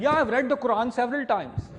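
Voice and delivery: mock Pakistani politician accent; Pervez Musharraf accent. Preacher mimicking someone he met for the audience, Pervez Musharraf accent. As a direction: put on a comic Pakistani accent. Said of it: "in their Pervez Musharraf accent".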